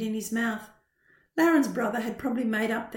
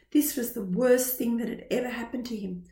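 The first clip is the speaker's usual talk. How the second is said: ordinary delivery, nothing marked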